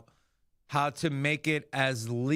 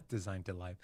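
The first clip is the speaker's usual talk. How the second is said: laughing through the words, much softer; 9 semitones lower, 6 dB quieter than usual. Real, not acted. Host rereading a product blurb, laughing at his own stumble. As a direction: chuckle the line out at your own slip.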